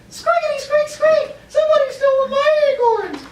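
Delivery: very high, squeaky and loud squirrel cry; panicked squirrel. Acted. Teacher voicing a robbed squirrel to students, very high squeal. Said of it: "Then Bridget goes".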